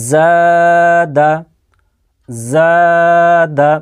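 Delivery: জাদা with a long held first "aa", short "da"; slow Qur'anic primer model reading; pronunciation demo. Shown as a demonstration. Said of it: "উচ্চারণটা দেখে নিন".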